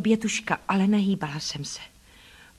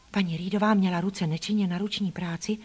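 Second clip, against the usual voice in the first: usual speaking voice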